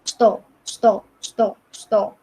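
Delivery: "sto" said clearly in Russian, short and crisp. Shown as a demonstration. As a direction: repeat "Sto, sto" slowly like a teacher. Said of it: "bahasa Rusianya sto". (ucapkan "sto" dengan jelas berulang-ulang)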